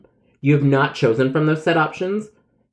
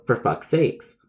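ordinary delivery

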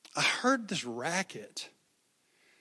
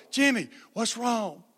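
higher, louder worried call; urgent calling; 8 semitones higher, 6 dB louder than usual. Retold or imitated. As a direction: call out to the boy in alarm. Friends calling to crying boy.

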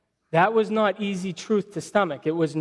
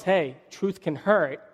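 ordinary talk, nothing marked

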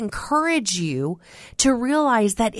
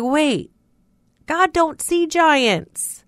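higher, slow dawning "God don't see giants"; sudden realization; 7 semitones higher, slower than usual. Retold or imitated. Preacher realizing aloud, high and slow. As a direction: let it dawn on you, slowly. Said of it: "I was like".

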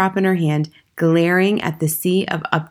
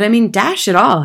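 put-on louder, higher-pitched boyish objection; flustered protest; 5 semitones higher, 6 dB louder than usual. A narrator's reading. Accused boy to headmistress, flustered. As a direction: protest with affronted bewilderment.

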